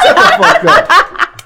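slow, high, laughing; laughing disbelief. Real, not acted. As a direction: drag it out in disbelief.

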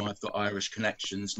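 ordinary talk, nothing marked

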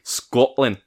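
proudly hammed Scottish accent. Shown as a demonstration. Podcaster showing off a party-trick word, Scottish accent. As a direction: show off your limited Scottish. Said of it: "My Scottish accent is off the chain".

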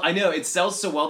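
ordinary talk, nothing marked

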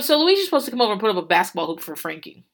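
normal talking voice

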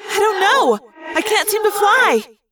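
high, loud panicked cry. Acted. Rachel to Cassie while falling, panicked cry. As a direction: fear breaking through the words.

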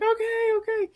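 very high, mock flustered placating; harried barmaid. Barmaid answering screaming gremlins, high flustered imitation. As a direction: squeak it out as a flustered server.

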